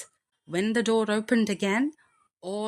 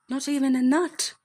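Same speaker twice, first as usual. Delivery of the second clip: high squeaky mouse voice; 7 semitones higher, 5 dB louder than usual. Acted. Jack's disappointment, empty stockings.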